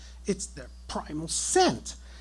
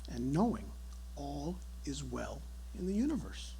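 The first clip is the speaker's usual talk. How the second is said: normal talking voice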